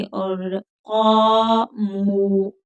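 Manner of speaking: क़ामू with both long vowels drawn out very slowly; stretched vowels. Shown as a demonstration. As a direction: sing out क़ामू with the aa and oo held long. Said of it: "थोड़ा खींच कर पढ़ेंगे".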